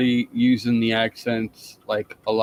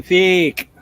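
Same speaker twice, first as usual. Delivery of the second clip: higher, louder stab at a British accent; attempted British accent; 6 semitones higher, 6 dB louder than usual. Shown as a demonstration. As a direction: try one British-sounding word, pitched up and loud. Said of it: "Closest I get is the saying".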